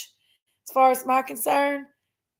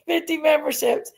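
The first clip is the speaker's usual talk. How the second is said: normal talking voice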